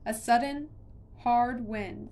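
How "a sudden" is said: ordinary delivery, nothing marked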